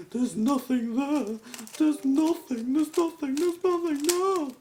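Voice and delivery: very high, loud hammed-up shrieking; mock panic. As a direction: wail it over and over in exaggerated horror. Acts out panic at empty-feeling cube.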